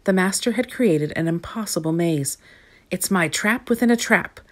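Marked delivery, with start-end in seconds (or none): none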